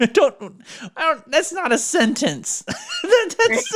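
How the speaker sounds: high voice breaking into laughs; words collapsing into laughter; laughing. Real, not acted. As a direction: object while cracking up. Host laughs at garbled answer.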